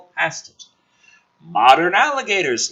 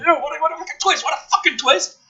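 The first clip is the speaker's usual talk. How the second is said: mock amazement, pitch way up; sarcastic scorn; 10 semitones higher, faster than usual. Real, not acted. Reader mocking the script's twist with scorn. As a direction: sneer at the plot twist.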